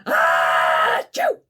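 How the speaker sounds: loud explosive hammed sneeze; big sneeze. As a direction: sneeze all over him. Grasshopper sneezes.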